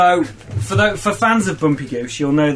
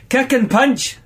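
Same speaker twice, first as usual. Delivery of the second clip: high, punchy Scottish character voice; second go at a Scottish accent; 8 semitones higher than usual. A narrator's reading. Reader retrying the teacher's line after slipping, Scottish accent. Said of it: "your best Scottish accent in this one".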